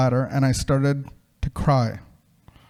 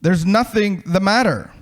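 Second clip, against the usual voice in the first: higher-pitched call from off in the distance; 4 semitones higher than usual. A narrator's reading. Someone calls to the man despairing in the hole, calling out. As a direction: call out to rouse someone far below. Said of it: "I heard someone call".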